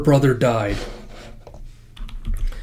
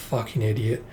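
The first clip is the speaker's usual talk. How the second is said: sighing mutter; 3 semitones lower, 10 dB quieter than usual. Real, not acted. Viewer mutters at the hero in exasperation. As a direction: mutter it under a sigh.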